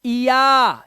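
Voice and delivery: इया drawn out in two parts; ee-ya sound. Shown as a demonstration. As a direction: glide from ee into a long aa on इया. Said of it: "उच्चारण होगा वो इया होगा".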